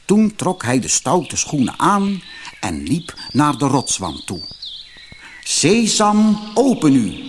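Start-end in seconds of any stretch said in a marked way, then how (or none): 5.4-7.1 pitched high and louder, a careful echo of the chief's command; copying the chief. A narrator's reading. Ali Baba nervously copies the robber chief at the rock. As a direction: try the magic words just as the chief said them. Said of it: "zoals hij de roverhoofdman had horen zeggen".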